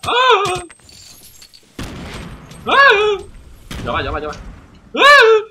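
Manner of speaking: high loud yelps of alarm; startled scream. Real, not acted. Streamer screams when enemies attack him. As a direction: yelp as if ambushed.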